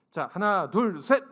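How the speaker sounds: loud rising count to start the ride. Retold or imitated. Father counting his daughter off, loud call.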